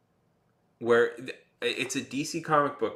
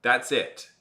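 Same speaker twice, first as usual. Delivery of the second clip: ordinary talk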